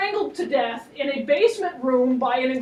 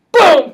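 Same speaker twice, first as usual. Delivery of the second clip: vocal gunshot; 9 dB louder than usual. Retold or imitated. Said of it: "gunshot sound".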